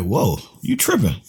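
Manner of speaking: ordinary talk, nothing marked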